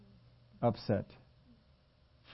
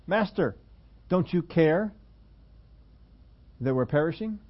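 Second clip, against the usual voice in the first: higher, urgent plea; mock alarm; 5 semitones higher than usual. A touch of panic. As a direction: disciples waking Jesus in the storm, mild alarm. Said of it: "Disciples ... wake Jesus up".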